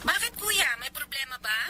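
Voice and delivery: faint, filtered telephone question; voice on the phone. Acted. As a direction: ask from the far end of a call. Heard from the other phone end. (mahinang boses sa telepono)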